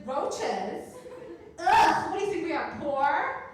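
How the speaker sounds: loud, high, scoffing put-on indignation; scoffing at the nosy cousin, loud and indignant; offended denial. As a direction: act insulted at the very idea. Denying roaches to cousin.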